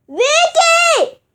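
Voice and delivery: high loud call across the house; calling the sister downstairs. Real, not acted. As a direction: yell her name to be heard.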